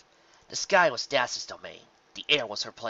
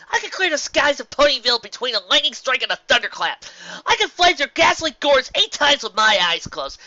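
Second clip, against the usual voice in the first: high, loud, defiant shout; rousing self-pep talk; 12 semitones higher, 12 dB louder than usual. Acted. Rainbow Dash shouting herself out of despair, loud and defiant. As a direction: shout yourself back into being Rainbow Dash.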